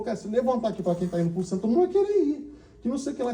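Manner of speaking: high-pitched mock arguing; retold bickering. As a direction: squeak out a heated objection, imitating him. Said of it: "Então, discutindo".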